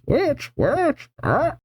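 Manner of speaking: high, soft squawking bird echo; parrot voice. Acted. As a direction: parrot the word back, squeaky. Parrot bird repeating question.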